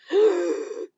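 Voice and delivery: sharp high gasp for air; thirsty gasp; parched gasp. Shown as a demonstration. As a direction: gasp like you finally got water. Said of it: "if you're feeling parched".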